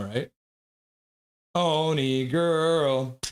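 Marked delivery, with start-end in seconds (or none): 1.5-3.2 very slow, savoring the slip; drawn out. Real, not acted. Streamer slowly repeats the word chat teased him about. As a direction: stretch it out, amused at his own slip.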